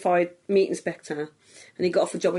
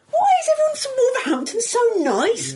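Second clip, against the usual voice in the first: high, baffled, almost wailing question; incredulous friend; 4 dB louder than usual. Retold or imitated. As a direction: play the stunned friend ringing late at night. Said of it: "And he went".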